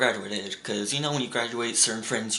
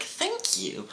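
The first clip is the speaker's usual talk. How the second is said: high prissy thank-you; sarcastic high-pitched thanks; smart-ass reply. Retold or imitated. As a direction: hand the sarcasm right back. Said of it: "a smart-ass remark back".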